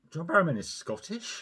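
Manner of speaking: imitating surprise, high-pitched query; mock disbelief, voice jumping up high; incredulous question. Acting out people's disbelieving reaction.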